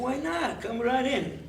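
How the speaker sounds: high, obliging invitation. Acted. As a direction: wave them in with a high-pitched welcome. Guest agrees to share room.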